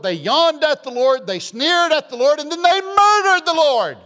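rising from loud to a high, ringing shout; building preaching cry. Acted. Preacher building to a shout over the Lord's murder, loud. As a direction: climb to a peak on murdered.

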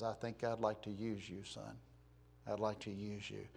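soft, low, understated voice; God. Acted. God calls Moses out of obscurity, quiet low voice. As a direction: make a quiet, low offer. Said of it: "And God says".

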